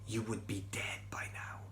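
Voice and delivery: hushed whispered warning; ominous whisper. Acted. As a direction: lean in and breathe the threat. Angel's grave warning.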